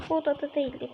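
ordinary talk

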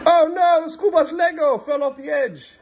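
high, loud playful oh no; hammed-up surprise; mock alarm. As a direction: play up the fall for the kids.

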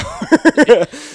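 loud, high burst of laughter; hearty laugh. Real, not acted. Laughing at his own crawling road trip, loud and hearty.

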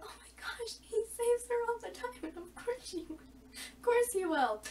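breathy high gush, slowing at the end; half-whispered swoon over the hero; swooning quote. Retold or imitated. Fan reenacting her swoon over the rescue, breathy and high. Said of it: "I was like".

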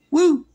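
loud, high-pitched "woo!"; joyful whoop. Real, not acted. Preacher exulting to congregation about heaven, joyful whoop. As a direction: let out a short, piercing whoop.

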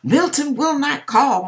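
hammed-up deliverance prayer; mock rebuking prayer. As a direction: rebuke Satan like a revival preacher. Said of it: "So we're rebuking".

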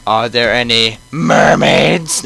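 loud, drawn-out mermaids; hammed-up excitement. Guest asks about mermaids, hammed-up excitement. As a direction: burst out with it, stretched out.

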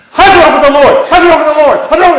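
very high, loud nagging repetition; mocking pushy evangelical. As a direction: hammer the question like a pushy preacher, mocking. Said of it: "we're just constantly at people".

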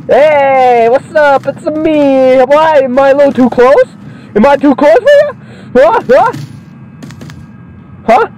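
high put-on voice, teasing and in-your-face; cocky wrestler voice. Gamer voicing his wrestler, cocky high teasing. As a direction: lean in like a smug brawler.

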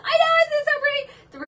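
high, loud and teary with delight; thrilled squeal. Real, not acted. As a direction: squeal over the pretty set. Thrilled over turquoise set.

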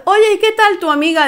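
mock casual ex.

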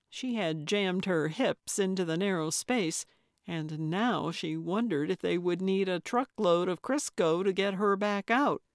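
usual speaking voice

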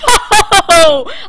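high, loud gloating laughter; loud victory laugh. Real, not acted. Player who just won the round, loud gloating laugh.

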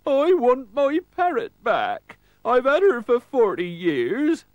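high, loud, slow comic wail; bawling pirate. A narrator's reading. Rattlesaber wants his parrot back, high loud tearful wail. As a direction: bawl it out in character. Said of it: "burst into tears".

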